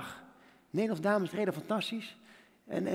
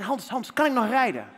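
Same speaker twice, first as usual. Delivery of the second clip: desperate plea; 4 semitones higher, 5 dB louder than usual. Retold or imitated. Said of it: "ik vraag meteen".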